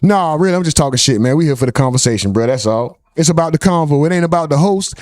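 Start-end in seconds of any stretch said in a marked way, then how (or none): none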